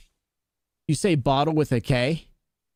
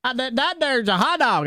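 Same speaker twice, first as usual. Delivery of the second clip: mock country accent; 9 semitones higher than usual. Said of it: "That's something else".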